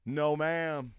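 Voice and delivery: normal talking voice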